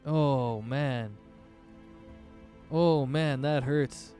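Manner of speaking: normal talking voice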